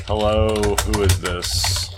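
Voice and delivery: slow, drawn-out phone greeting from the bartender; acted bartender line. Bartender answering the phone, slow acted greeting. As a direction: lightly voice the bartender picking up, slowly.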